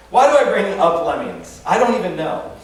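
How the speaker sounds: ordinary talk, nothing marked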